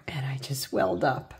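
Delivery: near-tears whisper, breath catching; welling up. Real, not acted. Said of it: "sorry, I'm tearing up".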